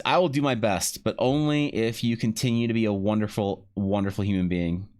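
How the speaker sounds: ordinary delivery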